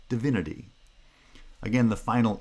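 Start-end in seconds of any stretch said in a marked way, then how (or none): 0.1-0.7 Divinity with stress on VIN and schwas in the first and third syllables; stress pattern demo. Shown as a demonstration. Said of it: "pronounced with schwa".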